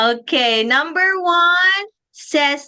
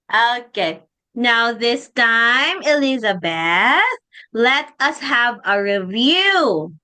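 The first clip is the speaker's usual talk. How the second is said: normal talking voice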